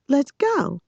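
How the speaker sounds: high-pitched, falling command; insistent. A narrator's reading. Girl repeating herself to a puzzled boy, insistent.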